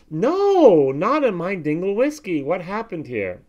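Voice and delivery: loud, high drawn-out cry, then settling; dismayed protest. Retold or imitated. Fan finding a fault in his Dingle whiskey, dismayed. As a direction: wail in mock disbelief.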